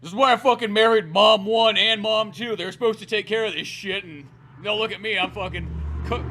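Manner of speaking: loud griping dad, easing off; lightly voiced exasperated working-class dad; blue-collar dad. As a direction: play a fed-up landscaper dad grumbling about his wives. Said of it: "blue-collar dad, just being like".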